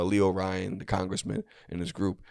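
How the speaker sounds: ordinary talk, nothing marked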